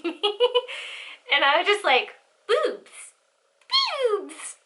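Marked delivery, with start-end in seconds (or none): 2.5-4.6 put-on high, silly drunken cooing; drunk impression. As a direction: call it out like a tipsy goof, pitched up. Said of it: "Oh, you're drunk".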